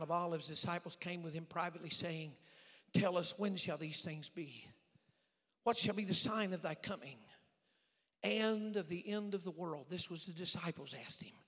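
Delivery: measured, slowed reading of the verse; solemn scripture reading. Acted. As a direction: read the Bible words deliberately.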